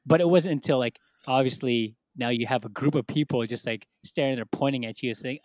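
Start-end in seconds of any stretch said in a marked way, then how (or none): none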